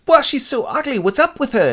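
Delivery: put-on high-pitched child voice; rude child. Twin child stares at dying Linda, rude high voice. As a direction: gawk out loud like a tactless child.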